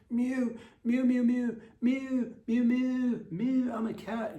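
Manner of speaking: very high squeaky kitten mews; kitten mewing. Pretend. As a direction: squeak like a tiny cat in a costume. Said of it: "You'll say that in your furry suit".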